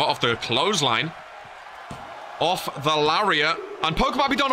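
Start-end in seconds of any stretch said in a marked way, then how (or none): 3.8-4.5 high, rising tension call; excited. Real, not acted. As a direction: sound sure it's over. Near-fall moment in match.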